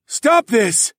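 shout. Acted. Stranger stopping execution.

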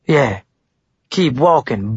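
mildly acid jeer; understated caustic taunt; acidic tone. A narrator's reading. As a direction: send him off with a touch of scorn. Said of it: "Blaine said in an acidic tone".

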